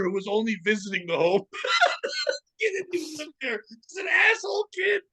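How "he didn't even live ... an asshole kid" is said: high, squealing through laughter; laughing hard. Real, not acted. Co-host reacting to the toddler story, laughing hard. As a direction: squeeze the words out while cracking up.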